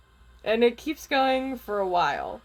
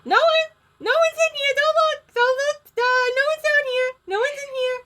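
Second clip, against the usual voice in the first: frantic, very high squeal; panicked Gizmo; 5 dB louder than usual. Acted. Gizmo denies anyone is under the desk, panicked squeal. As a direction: blurt it out loud and piercingly high. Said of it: "I can't do a panic Gizmo".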